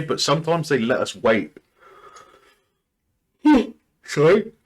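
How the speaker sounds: talking through a yawn; words stretched by a yawn. Real, not acted. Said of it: "Sorry, I'm yawning".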